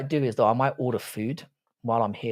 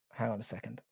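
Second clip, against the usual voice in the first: much softer, half to himself; muttered under breath; 2 semitones lower, 15 dB quieter than usual. Real, not acted. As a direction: mutter it while distracted. Repeats it to himself while busy.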